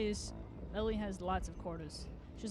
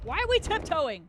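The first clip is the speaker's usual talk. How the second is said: exasperated yell; 7 semitones higher, 10 dB louder than usual. Real, not acted. Frustrated at game movement.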